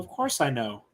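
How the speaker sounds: normal talking voice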